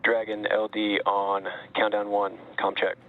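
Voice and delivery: radio headset voice; over a pilot headset. Real, not acted. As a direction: talk through a comms headset. Controller calls over headset loop.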